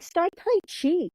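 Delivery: high, drawn-out mock advice. Quoting teammates who mock her age, high and slow. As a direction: sneer it slowly like teasing friends.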